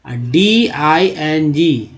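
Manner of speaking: "डीआईएनजी" spelled letter by letter; spelled out slowly. Shown as a demonstration. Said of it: "एको". ("डीआईएनजी" धीरे धीरे अक्षर अक्षर बोलें)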